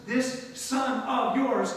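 "This son of yours" very slow and hard; contemptuous phrase. Shown as a demonstration. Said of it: "You see the difference?".

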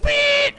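high, loud beep noise; high-pitched sound-effect imitation; imitated beep. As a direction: squeal out a mock beep. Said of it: "it went".

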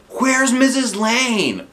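high, loud, slow; demanding in unison; asking loudly. Acted. Kids asking together.

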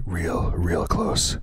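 loud mic test. Shown as a demonstration. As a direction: say it loud to hit the meter. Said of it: "Let me get it really close".

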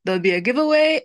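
normal talking voice

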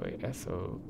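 ordinary talk

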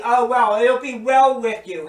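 breezy reassurance. Pretend. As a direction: toss it off like empty comfort. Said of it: "we can say".

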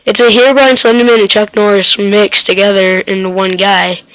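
ordinary delivery

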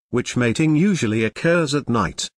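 normal talking voice

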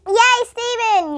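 high, loud, slow cheer; cheering yell. A narrator's reading. Jenny cheering Stephen's success, loud yell. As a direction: stretch out the yay. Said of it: "yelled Jenny".

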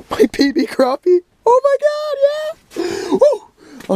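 very high, squealed whooping; giddy celebration. Real, not acted. Angler showing off the personal best, giddy. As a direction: burst into a squeal of joy.

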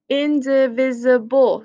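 Indivisible said slowly, syllable by syllable; teacher shows the class the word Indivisible slowly; slow careful teacher demo. Shown as a demonstration. Teacher pointing out a big word, said slowly and clearly. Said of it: "My teacher points to one of the words".

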